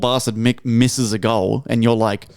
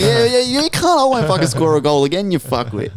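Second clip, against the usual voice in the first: mock taunt; 6 semitones higher than usual. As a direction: jeer back at the crowd, playfully. Acts out player taunting the crowd.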